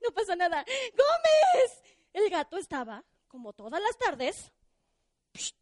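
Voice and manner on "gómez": high, loud call out; calling the cat. Acted. Liliana calling loudly for her cat Gómez, high and loud. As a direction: shout for the cat.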